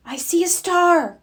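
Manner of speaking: high, delighted call; excited cry. A narrator's reading. Sister spots the first star, high and delighted. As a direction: announce the find proudly. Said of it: "cried Sister".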